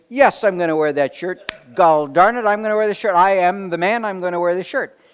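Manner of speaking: hammed-up stubborn husband standing his ground; mock defiant shout. Husband blustering back at wife about the shirt, mock shouting.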